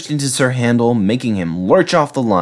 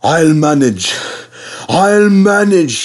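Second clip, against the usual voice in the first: higher, louder, mildly wheezy effort; wheezing; 8 semitones higher, 4 dB louder than usual. A narrator's reading. Tired Skarloey reassuring his driver, wheezing. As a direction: strain it out on the climb. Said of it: "he wheezed".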